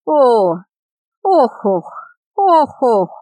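long pained groans in sleep; moaning in sleep. A narrator's reading. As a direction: groan out like a nightmare. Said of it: "застонал во сне юноша".

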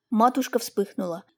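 plain reading voice

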